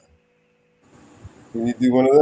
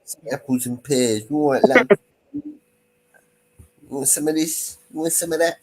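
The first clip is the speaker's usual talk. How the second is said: mock cockney accent; 5 semitones higher than usual. Mocking London cockney talk.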